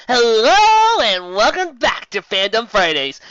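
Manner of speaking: hammed-up host greeting; show intro.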